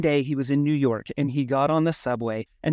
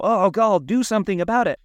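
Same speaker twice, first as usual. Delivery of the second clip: exasperated outburst, higher pitched; higher, impatient mock complaint; annoyed and frustrated; 5 semitones higher, 3 dB louder than usual. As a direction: act out a fed-up onlooker. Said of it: "like, annoyed and frustrated".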